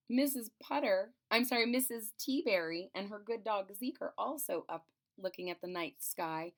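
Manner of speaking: ordinary delivery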